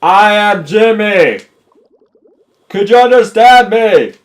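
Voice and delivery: loud, high, slow holler; big over-clear shouting; yelling to be understood. Pretend. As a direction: yell an introduction word by word. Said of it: "I have to yell".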